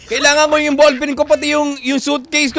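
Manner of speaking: loud, high angry yell; angry shout. Acted. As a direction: demand an answer from the office. Searching for stolen things.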